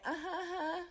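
high, thin, placating uh-huh; fake polite uh-huh. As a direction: brush them off with a sing-song fake agreement. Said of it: "I really be like".

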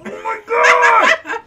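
slow, high, loud wail; drawn-out laughing exasperation; exasperated outburst. Real, not acted. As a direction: wail in disbelief at the blunder. Exasperated at partner's game mistake.

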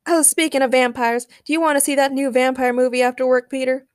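eager invitation. A narrator's reading. Daniel invites Peter to a vampire movie, eager. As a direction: pitch the idea brightly to a friend.

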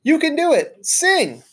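very high squeaky call; squeaky cheer. Acted. Hamster cheering Saya on in a high squeak. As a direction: urge her on at the top of a tiny voice. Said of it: "I squeaked out as loudly as I could".

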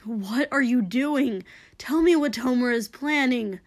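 loud, pressing interrogation; urgent demand. Acted. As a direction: demand answers over the fight. Hero shouts questions mid-fight.

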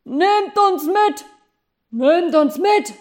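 high, loud and slow call; workers calling. Acted. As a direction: call up to the geese overhead. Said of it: "rief eine ganze Menge Arbeiter".

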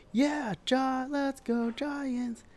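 high, slow goofy cheer; high silly cheer; mock Giants fan. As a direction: lampoon a gloating fan, dragged out.

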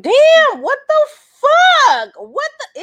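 high, loud, startled damn; jolted awake and outraged; shocked outburst. Retold or imitated. Woken at three in the morning, shocked and loud.

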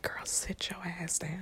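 stern hushed whisper from God; quiet order, no voice at all. Retold or imitated. God telling her to sit down, whispered. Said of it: "Yes, I'm whispering".

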